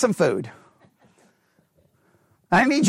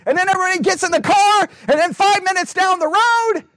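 ordinary talk

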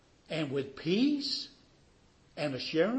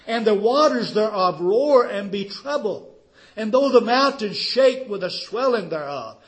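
loud, raised-pitch scripture declamation; full-force preaching; 7 semitones higher, 12 dB louder than usual. Real, not acted. Preacher reciting Luther's psalm to the congregation, full-force preaching. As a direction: proclaim the psalm forcefully to the back pew.

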